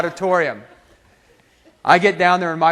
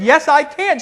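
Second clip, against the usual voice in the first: insistent retort; 7 semitones higher than usual. Retold or imitated. Student argues back at professor.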